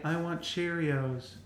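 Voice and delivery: put-on slow, soft ghostly child; whisper-soft child in the dark; creepy child voice. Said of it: "anything a kid would say".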